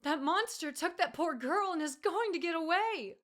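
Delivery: high, loud, cracking desperation; desperate cry with a breaking voice. A narrator's reading. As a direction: let the voice crack as she pleads. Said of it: "her voice breaking".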